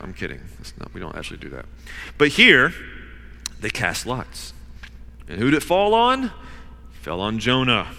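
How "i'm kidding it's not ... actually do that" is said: quick aside. Real, not acted. Joke aside to congregation.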